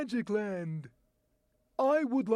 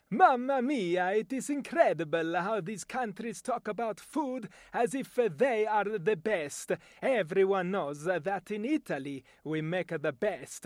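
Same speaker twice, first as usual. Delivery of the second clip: a proud Italian praising his country's food; Italian accent. Shown as a demonstration. An Italian bragging about pizza, in an Italian accent. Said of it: "my voice talent demonstration".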